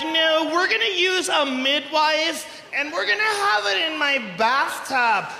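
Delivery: slow, mocking imitation of an earnest mother; mock natural-birth mother. Comic mocks women choosing a midwife, slow mocking voice.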